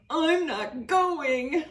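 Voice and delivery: high, very slow, muffled sulk; sulky refusal. A narrator's reading. Girl refusing her mother from her pillow, sulky and slow. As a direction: refuse through tears into the pillow.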